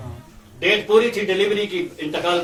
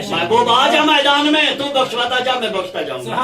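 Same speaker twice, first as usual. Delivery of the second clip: high, loud call to the beloved; God calling the Prophet forward, high and loud; divine call; 5 semitones higher, 6 dB louder than usual. Acted. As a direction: summon him onto the field.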